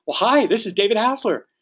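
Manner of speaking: high, matching enthusiasm; right up there. Acted. Agent matching an excited client's emotional state, high and upbeat. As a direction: greet them with lifted, excited energy. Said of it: "you better be right up there".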